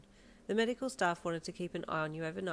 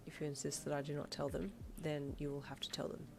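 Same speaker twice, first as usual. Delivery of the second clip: lightly voiced stern doctor, quieter; 3 semitones lower, 7 dB quieter than usual. Doctor insisting the parents be told, stern. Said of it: "he said sternly".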